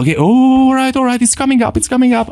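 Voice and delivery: high, tense self-talk; a hint of mounting alarm; self-warning. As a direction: warn yourself anger is coming. Acknowledging rising anger aloud.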